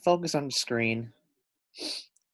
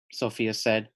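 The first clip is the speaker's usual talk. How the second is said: usual speaking voice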